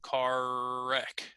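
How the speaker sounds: CAR WRECK joined by one long R; stretched R. Shown as a demonstration. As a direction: hold the r of "Car" right into "wreck". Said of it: "See how I can stretch that".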